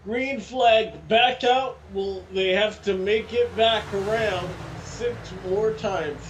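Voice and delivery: loud, high race call; excited commentator call. Real, not acted. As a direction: shout the green flag out. Green flag, restart called.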